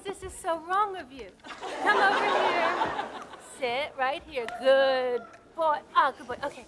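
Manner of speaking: normal talking voice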